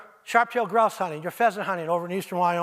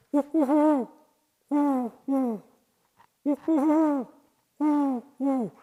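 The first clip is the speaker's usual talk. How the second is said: high hooting, a little louder; higher owl hoots; 7 semitones higher, 4 dB louder, slower than usual. Shown as a demonstration. Showing the high voice of the owl pair, high hoots. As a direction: hoot up high like the female owl. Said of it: "but you hear".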